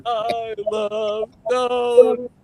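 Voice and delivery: high, very slow, strained recruit; struggling recruit's shout. Retold or imitated. Punished recruit repeating donuts line, high drawn-out cry. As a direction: drag each word out high, imitating the recruit. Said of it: "So then Poe says".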